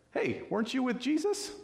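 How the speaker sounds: put-on high-pitched questioning servant girl. Servant girl confronting nervous Peter, high imitated voice. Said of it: "this little servant girl comes up and goes".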